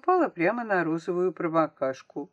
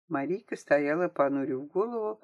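ordinary delivery, nothing marked

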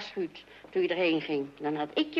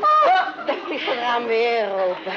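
very high, loud laughing oh; exasperated exclamation; 4 semitones higher, 7 dB louder, slower than usual. Acted. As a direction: cry out at the open window again. Window found open yet again.

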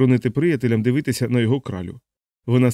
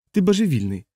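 higher, mildly cross retort; displeased, pitched up then falling; snappish retort; 6 semitones higher than usual. A narrator's reading. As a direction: snap back on the way out. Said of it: "огризнулася вона вже з дверей".

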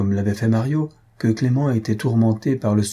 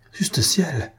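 cry of dismay; 6 semitones higher than usual. A narrator's reading. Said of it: "s'écria Clément".

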